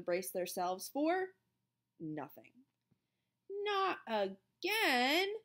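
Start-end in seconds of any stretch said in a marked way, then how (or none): 3.5-5.3 high, very drawn-out moan. A narrator's reading. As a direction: moan it slowly in exasperation. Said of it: "moaned Calvin".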